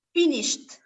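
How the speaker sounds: "finished" ending in a 't' sound, no extra syllable; final T sound. Shown as a demonstration. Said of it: "va a ser T". ("finished" terminado en sonido 't')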